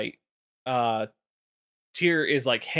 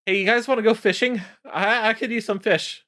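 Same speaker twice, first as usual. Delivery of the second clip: high, fast sudden cheeriness; goofy cheerful; 7 semitones higher than usual. Retold or imitated. Malygos to the others, suddenly cheerful about fishing. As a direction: switch to a bright fishing invite.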